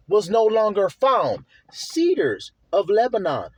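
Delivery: "Satan's place" and "Cedars of Lebanon" drawn out slowly, set apart by pauses; slow, pointed stress on "Satan's place", "no longer found" and "Cedars of Lebanon"; deliberate emphasis. Real, not acted. Preacher linking two verses for his flock, emphasizing "Satan's place" and "Cedars of Lebanon". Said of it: "why I'm emphasizing that".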